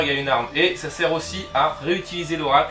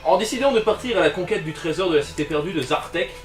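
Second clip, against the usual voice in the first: dramatic reading; 4 semitones higher, 4 dB louder than usual. Acted. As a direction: read it like an adventure narrator. Reads the danger card dramatically.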